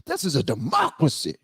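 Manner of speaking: imitating her emphatic proclamation; mock chesty declaration. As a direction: mockingly lean hard on "democracy". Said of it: "she said it with her chest".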